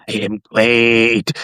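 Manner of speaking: slow mutant impression. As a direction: draw it out like a movie mutant. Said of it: "the mutant's like".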